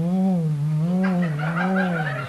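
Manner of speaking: booming woom sounds for a pounding heart; heartbeat noise. Retold or imitated. Drunk man mimics his own heart pounding after the beer, booming woom sounds. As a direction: thump it out like a heart hammering in your chest.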